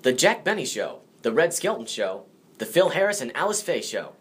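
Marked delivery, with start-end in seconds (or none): none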